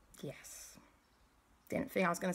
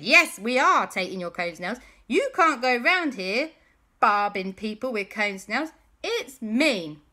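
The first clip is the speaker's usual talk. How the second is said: loud, raised-pitch scolding; indignant telling-off; 4 semitones higher, 8 dB louder than usual. Acted. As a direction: stand up to the bully. Boys tell off pirate.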